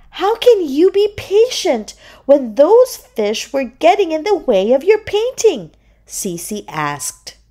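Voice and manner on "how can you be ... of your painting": high-pitched seahorse question; curious seahorse. A narrator's reading. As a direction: ask wonderingly in a high little voice. Seahorse asks the shark admiringly.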